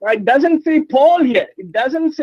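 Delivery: insistent high-pitched objection, stretched out. Real, not acted. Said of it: "Stop screaming".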